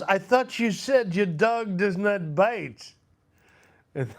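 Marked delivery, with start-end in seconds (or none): 0.0-2.9 high, loud, slow comic protest; Peter Sellers. Acted. Bitten man protesting to the guy, high loud mock indignation. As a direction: exclaim it indignantly in a drawn-out film impression. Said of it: "And he goes".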